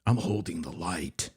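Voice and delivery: soft unvoiced whisper; spooky mock whisper. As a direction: breathe it like a secret. Whispered mock ominous line.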